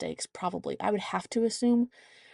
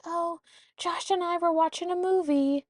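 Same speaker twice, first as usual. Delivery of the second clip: put-on child voice; 10 semitones higher than usual. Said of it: "And Ava's like".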